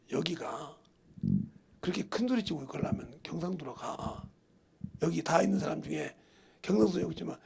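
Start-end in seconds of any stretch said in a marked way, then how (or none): 0.1-4.3 hushed retold rebuke. Retold rebuke to shop owner.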